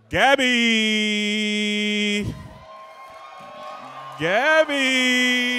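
loud drawn-out name call, hyping the crowd; host announcement. Acted. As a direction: announce her like a ring host.

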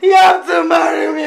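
loud, fast, insistent demand; shouted demand. Acted. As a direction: insist at the top of your voice. Woman demands marriage in quarrel.

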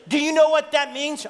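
high, loud urgent question; yelling. Retold or imitated. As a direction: shout it in alarm. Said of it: "when he yells at me".

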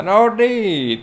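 high, loud "No niin"; excited cheer. Real, not acted. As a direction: cheer on the pin. Cheering a pin attempt.

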